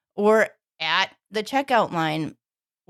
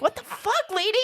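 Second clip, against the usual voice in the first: incredulous; 12 semitones higher than usual. Retold or imitated. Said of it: "And I'm like".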